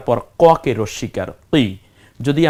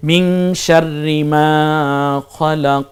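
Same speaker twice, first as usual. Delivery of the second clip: শাররি মা খলাক chanted slowly, louder; slow chanted recitation; 3 semitones higher, 3 dB louder, much slower than usual. Shown as a demonstration. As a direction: recite it in tilawat style. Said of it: "বলেন খলাক".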